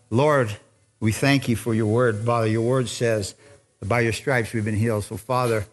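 low, measured congregational prayer; leading prayer. Real, not acted. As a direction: pray in short phrases with long pauses.

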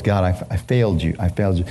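a hint of a broken confession; mild retold remorse. Preacher retelling his confession to God, low and sad.

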